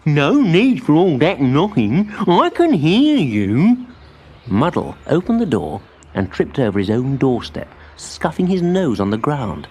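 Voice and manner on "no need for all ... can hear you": loud, slow grumble; fussy old mole. A narrator's reading. Muddle the Mole grumbles at his visitors, loud and slow. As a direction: complain about the knocking.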